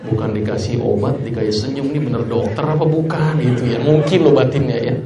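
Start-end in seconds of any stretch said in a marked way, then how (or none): none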